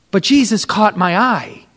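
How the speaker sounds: ordinary delivery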